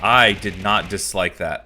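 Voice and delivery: loud, slow indignant denial; loud protest. Real, not acted. Defending his taste in movies, loud and slow. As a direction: drag it out, loudly protesting.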